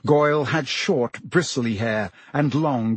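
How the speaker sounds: usual speaking voice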